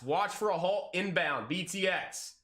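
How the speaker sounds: ordinary talk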